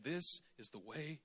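soft, slow, low voice; gentle guiding voice. Retold or imitated. The voice behind you guiding the way, soft and slow. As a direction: guide them quietly from behind. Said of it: "a voice behind you saying".

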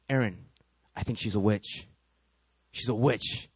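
low, ominous confiding; grave suspicion. Acted. Husband telling wife his suspicion, low and grave. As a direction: say it low and deliberately, like a dark verdict.

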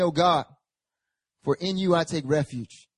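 ordinary talk

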